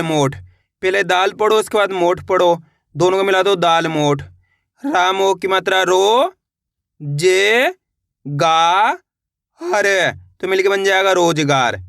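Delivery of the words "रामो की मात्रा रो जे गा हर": slow drill, "रो", "जे", "गा" held long and rising; syllable-by-syllable spelling. Shown as a demonstration. Teacher leading learners to repeat along, slowly spelled out. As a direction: sound out each piece, pausing between "जे", "गा", "हर". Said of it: "मेरे साथ साथ इनको repeat करना है".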